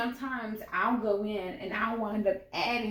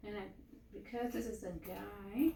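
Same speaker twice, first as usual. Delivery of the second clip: ordinary talk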